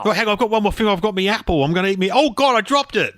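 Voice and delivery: casual workman turning to sudden dismay; working-class gardener. Pretend. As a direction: chatter on, then exclaim at the mishap. Plays the gardener in sketch.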